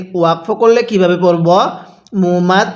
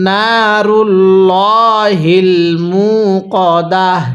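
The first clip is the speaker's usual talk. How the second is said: slow, drawn-out tajweed chant; Quran recitation; much slower than usual. Shown as a demonstration. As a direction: stretch every vowel in measured recitation.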